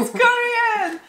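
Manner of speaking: surprised. Real, not acted. Surprise at snack origin.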